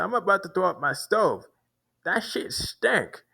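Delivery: high mock voice, slowing on the last words; imitating the man. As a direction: drag out that shit stank up high. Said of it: "That's how they talk".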